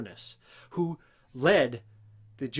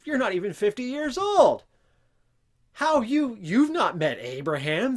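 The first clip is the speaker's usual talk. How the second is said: much higher, louder disbelieving retort; incredulous; 7 semitones higher, 7 dB louder than usual. Retold or imitated. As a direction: scoff in shocked disbelief like the scribes. Pharisees disbelieve Jesus' claim.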